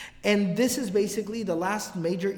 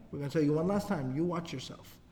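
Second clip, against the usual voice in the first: low and quiet, menacing; a quiet final warning; 4 semitones lower, 7 dB quieter than usual. Retold or imitated. Ansar warning Abdullah one last time, low and quiet.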